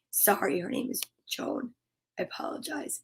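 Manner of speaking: hushed, almost whispered apology; quiet apology. Real, not acted. Host apologising to a viewer for the name, near whisper. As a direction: drop very soft and low to apologise.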